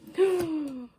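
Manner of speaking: a quick delighted gasp as the lid comes off; sharp intake of breath, then let it fall; surprised gasp. Real, not acted. Opening the monthly cactus box.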